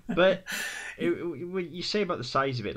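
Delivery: words bubbling with a laugh. Real, not acted. Laughing at the boomerang joke.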